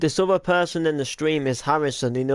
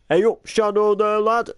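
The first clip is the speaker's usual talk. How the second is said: higher Northern hello; cheery Northern greeting; 7 semitones higher, 3 dB louder than usual. Shown as a demonstration. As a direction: call it up brightly. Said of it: "I do my normal voice and then go".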